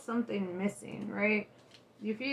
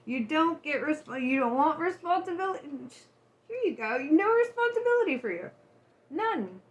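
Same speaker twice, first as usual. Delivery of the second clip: higher, louder, scolding voice of the universe; voicing the universe; 5 semitones higher, 5 dB louder than usual. Universe scolds the client who refused responsibility, higher and louder. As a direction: lay down the law as the universe. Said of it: "The universe is like".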